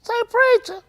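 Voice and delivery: very high, squeaky put-on voice; mimicking voice. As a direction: squeak it out as a mocking imitation. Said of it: "Can you talk like that?".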